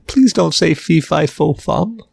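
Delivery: mild mock pleading, rising at the end; imitated begging. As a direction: lightly plead like the nervous short guy. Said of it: "looked like he just was begging".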